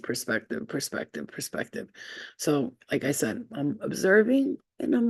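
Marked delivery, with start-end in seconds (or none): 0.0-1.9 repeated word fading away into a whisper. Pretend. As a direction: let each repeat die softer until it is breathed.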